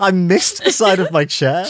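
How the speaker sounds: laughing through the words after a near fall; giggling while explaining. Real, not acted.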